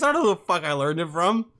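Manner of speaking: ordinary talk, nothing marked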